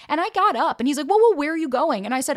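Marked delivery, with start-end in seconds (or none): 1.1-2.0 alarmed. Retold or imitated. Acts boyfriend alarmed as she leaves.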